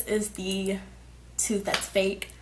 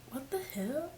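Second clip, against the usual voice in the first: hushed, higher puzzled question; stunned disbelief; 5 semitones higher, 11 dB quieter than usual. Retold or imitated. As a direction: murmur it in dawning shock. Discovering jagged gap in teeth.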